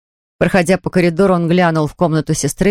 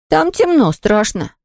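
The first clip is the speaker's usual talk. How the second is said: put-on high-pitched child complaining of the dark; frightened boy; 7 semitones higher, slower than usual. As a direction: voice a scared son, pitched up. Сын боится идти в магазин. (испуганный мальчик, высоким голосом)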